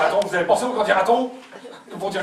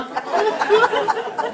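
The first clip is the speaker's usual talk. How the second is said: squeaky yelp. Acted. Child's squeaky aside.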